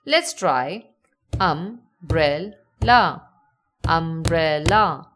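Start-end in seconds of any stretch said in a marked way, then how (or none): 1.4-2.5 "umbrella" slowly in three separate beats, um-brel-la; slow syllables. Shown as a demonstration. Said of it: "say the word slowly". 3.9-5.1 "umbrella" drawn out slowly, louder, syllables clearly marked; slow, measured word. Shown as a demonstration. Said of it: "say the word slowly".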